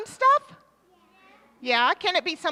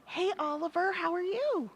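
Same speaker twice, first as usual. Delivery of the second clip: softer, well-mannered hello; polite quiet greeting; 3 semitones higher, 6 dB quieter than usual. Pretend. Showing kids fancy party manners, soft and polite. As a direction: greet him gently and properly. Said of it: "Or do you go".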